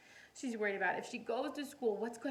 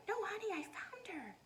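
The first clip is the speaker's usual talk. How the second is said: soothing mother; 8 semitones higher, 5 dB quieter than usual. Acted.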